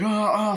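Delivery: high imitated yelps; hammed-up victim's "ah, ah"; mock death cries. Film fan acting out a kill, mock yelps.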